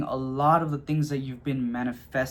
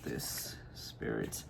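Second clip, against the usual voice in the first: whispering; 15 dB quieter, much slower than usual. Real, not acted. Whispering a question to the spirit.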